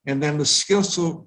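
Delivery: ordinary talk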